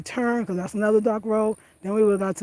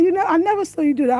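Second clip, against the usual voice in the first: husband quoted; 10 semitones higher, 6 dB louder, faster than usual. Retold or imitated. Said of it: "He said".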